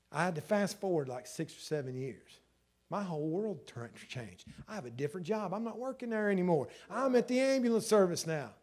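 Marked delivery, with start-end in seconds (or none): none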